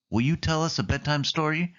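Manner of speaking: high, pleading ask; a hint of an eager kid; childlike pleading. A narrator's reading. Mater asks Sally.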